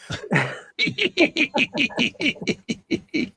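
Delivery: long high-pitched giggle; high giggling laugh. Real, not acted. Laughing at the tank figure jokes, long high giggle. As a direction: giggle helplessly in a rapid string.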